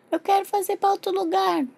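put-on high babyish demand; whining baby. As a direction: whine like a fussy little one. Pretend baby demands another place. (bebê manhoso exigindo)

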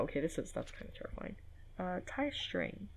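ordinary delivery

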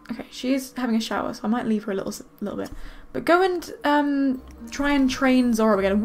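ordinary talk, nothing marked

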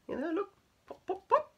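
high pop pop sounds; popping noise. Shown as a demonstration. Imitating rabbit popping sound.

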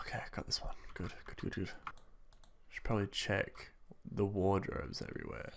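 hushed self-encouragement under breath; whispering. Real, not acted. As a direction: whisper it so nothing hears.

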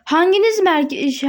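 ordinary talk

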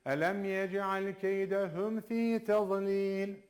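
slow chanted recitation; Quran recitation. Shown as a demonstration. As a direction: recite the verse slowly, with tajweed. Repeats verse. (yavaş tecvidli tilavet)

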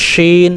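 শিন with airy sh and long ee; thick palatal sound. Shown as a demonstration. Teacher showing this is the way, thick long শিন. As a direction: let the air out on sh, stretch শিন. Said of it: "এইভাবে".

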